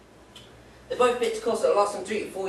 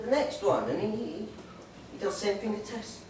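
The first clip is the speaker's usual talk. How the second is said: ordinary delivery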